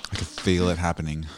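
ordinary talk

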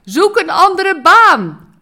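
loud, high, slow yell; a voice from afar yelling at a tired worker; distant shout. Retold or imitated. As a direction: call it out from far off.

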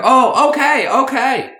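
put-on very high, loud caricature Chinese accent; mock Chinese accent. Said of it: "The Chinese guy".

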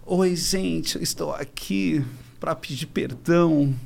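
soft, slow contrite apology; hushed, humble public apology voice; Pugliese apologizing. Retold or imitated. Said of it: "E aí depois ela".

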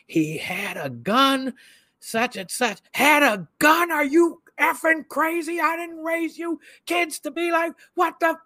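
very high, loud mock parental tirade; father screaming, imitated. Upset father to child in interrogation room, imitated screaming. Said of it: "screaming at the top of his lungs".

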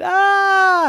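short high shriek of fright; high panicked ah; high startled yelp. Acted. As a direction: yelp and bolt. Nicholas flees in fright.

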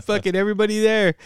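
high-pitched indignant retelling; mad outburst. Retold or imitated. Stoned sibling learns he was ratted out, mad retold outburst. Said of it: "I was fucking mad at him".

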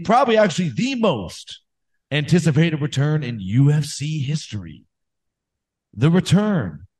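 slow, dramatic hype announcer; wrestling promo. Pretend. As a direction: build the hype like a fight promo. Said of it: "gonna do a promo".

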